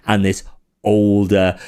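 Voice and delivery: ordinary delivery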